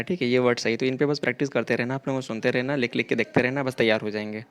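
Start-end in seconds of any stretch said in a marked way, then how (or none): none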